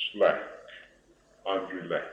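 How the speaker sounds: ordinary talk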